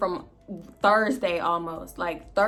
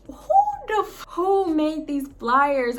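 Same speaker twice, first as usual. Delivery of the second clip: slow, higher, incredulous; stunned question; 8 semitones higher, 5 dB louder than usual. Retold or imitated. Finding party flyers in the elevator, slow stunned disbelief. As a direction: drag it out in disbelief.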